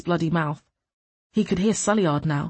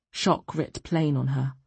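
ordinary delivery, nothing marked

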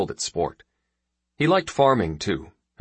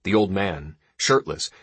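plain reading voice

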